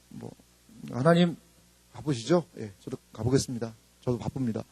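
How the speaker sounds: low, soft, resigned formality; mock perfunctory prayer. Weary believer brushing God off, perfunctory and subdued. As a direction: act out a detached, dutiful report. Acts out distant, self-reliant prayer.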